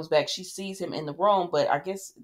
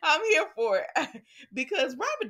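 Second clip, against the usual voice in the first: laughing; 9 semitones higher than usual. Real, not acted. Laughing about Robert.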